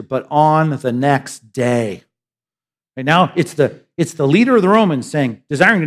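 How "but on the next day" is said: each word drawn out, savoring it; on the next day, said slowly and lingering. Shown as a demonstration. Preacher lingering over the text's first words, slowly. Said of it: "I just love the first words here".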